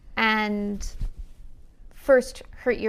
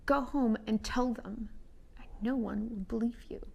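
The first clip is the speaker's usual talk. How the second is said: menacing whisper; 3 dB quieter than usual. Acted. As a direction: whisper it as a quiet threat. GM whispers deer woman's threat.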